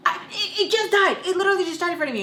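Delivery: high, flustered mock panic; high-pitched defensive babble; panicked protest. Panicked answer to being yelled at.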